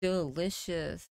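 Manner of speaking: slow, drawn-out catchphrase; slow game-announcer imitation; Candy Crush announcer. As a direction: say it randomly as a stretched game quote. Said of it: "I would just randomly say".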